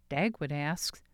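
normal talking voice

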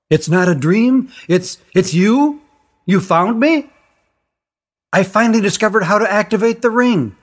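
put-on a girl thrilled to find her friend; excited girl talking through a magic ring; young girl Tally. A narrator's reading.

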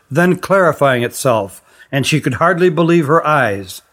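ordinary delivery

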